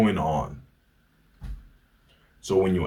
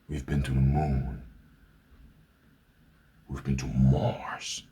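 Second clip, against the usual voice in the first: awed whisper; 6 semitones lower, 6 dB quieter than usual. Real, not acted. Telling viewers of human progress, awed whisper. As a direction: hush it like a secret wonder. Climax of hopeful story.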